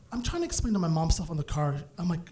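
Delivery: ordinary talk, nothing marked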